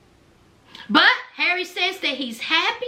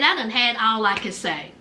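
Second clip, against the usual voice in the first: low weary Indy; 9 semitones lower than usual. Retold or imitated. Host voicing Indy giving up on Harry, low and weary. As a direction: let it sink low and tired. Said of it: "Indy said".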